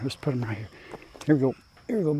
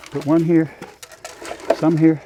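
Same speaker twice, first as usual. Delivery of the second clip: ordinary talk, nothing marked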